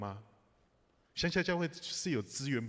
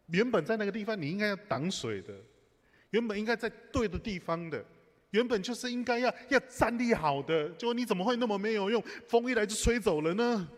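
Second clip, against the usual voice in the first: higher and louder, an exasperated mock scolding; scolding the door; 8 semitones higher, 5 dB louder than usual. Pastor retelling how he scolded the flood-blown door, exasperated. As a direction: berate the useless door, half joking.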